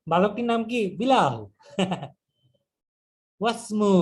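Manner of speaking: usual speaking voice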